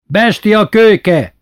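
high, loud, slow furious cry; angry shout. Retold or imitated. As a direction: yell at the rascal, drawn out. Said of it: "kiáltotta".

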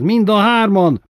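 high, loud furious yell; hoarse yelling. A narrator's reading. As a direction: scream it at the men spying on her. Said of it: "ordította rekedten".

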